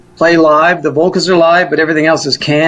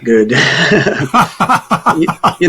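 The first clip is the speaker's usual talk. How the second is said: laughing, then a quick laughing question; real laugh spilling into the words, fast. Real, not acted. Laughs recalling her talent.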